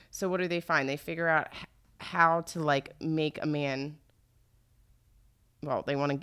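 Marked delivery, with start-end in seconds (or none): none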